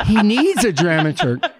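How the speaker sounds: said through a real laugh. Real, not acted. Playwright teasing David Mamet, talking through laughter. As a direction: let the laugh carry the words.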